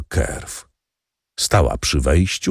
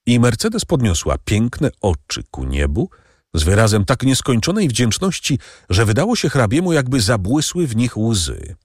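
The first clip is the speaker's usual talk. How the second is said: ordinary delivery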